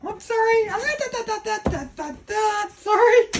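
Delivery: high playful pet-talk singsong; sing-song apology to pet. Real, not acted. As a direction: coo an apology to a startled dog. Apologizing to the dog after yelling.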